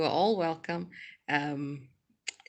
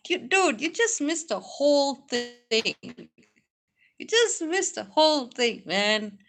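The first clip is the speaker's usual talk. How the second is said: high, drawn-out mock dismay; teasing dismay; 8 semitones higher, 5 dB louder, slower than usual. Real, not acted. To a viewer who missed the whole thing, teasing dismay. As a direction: tease them, stretching out the words.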